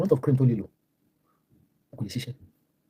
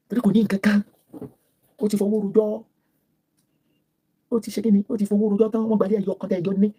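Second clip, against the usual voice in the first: mocking put-on voice; 8 semitones higher than usual. Mocking voice of the rival.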